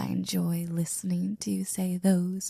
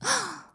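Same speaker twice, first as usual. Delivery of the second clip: sharp high theatrical gasp; mock offended gasp; 11 semitones higher than usual. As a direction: gasp as if scandalized. Feigned shock at implication.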